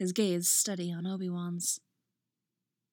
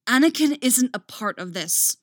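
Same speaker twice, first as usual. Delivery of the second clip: loud, higher exclamation; exclaiming; 4 semitones higher, 7 dB louder than usual. A narrator's reading. Obi-Wan pleads with Dooku for Anakin, exclaiming. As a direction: cry it out, fighting fear. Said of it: "Obi-Wan exclaims".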